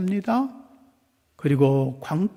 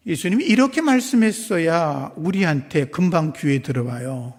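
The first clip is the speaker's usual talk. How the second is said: normal talking voice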